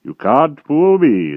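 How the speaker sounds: loud, slow, heavy giant voice; dim brutal giant. A narrator's reading. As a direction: drag each word out like a lumbering brute. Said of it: "said Gallopus".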